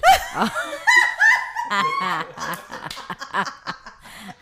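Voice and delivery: loud squealing laugh; real laughing fit; high burst of laughter. Real, not acted. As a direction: let the laugh explode high.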